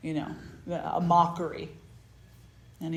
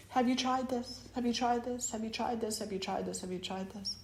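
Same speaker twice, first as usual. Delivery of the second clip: fading voices; 5 semitones higher, 7 dB quieter than usual. Acted. Line of people offering fixes.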